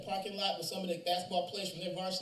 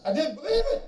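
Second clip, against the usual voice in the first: much higher and louder, a voice breaking into sobs; crying teenager; 10 semitones higher, 9 dB louder than usual. Retold or imitated. Teen sobbing to his teacher at the King grave, high breaking cry. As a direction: cry the words out in a high wail. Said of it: "He's crying".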